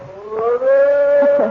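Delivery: sudden high, loud gasp of a question; startled fright. Acted. Jane hears a noise in the attic, startled and afraid. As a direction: jolt up in alarm at the noise.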